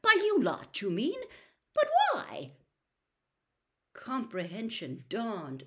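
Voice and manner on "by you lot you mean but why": high, loud disbelief; astonished. A narrator's reading. Bagman hears who was stunned, astonished. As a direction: blurt it out in rising astonishment.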